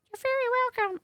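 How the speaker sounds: a hint of a crooner answering. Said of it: "I don't know what his voice sounds like".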